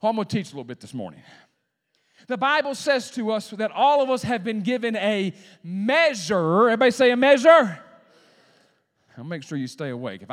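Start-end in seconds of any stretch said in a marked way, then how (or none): 2.3-7.8 rally the congregation to say it back; full-force preaching. Real, not acted. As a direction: proclaim it, swelling to a shout at the end.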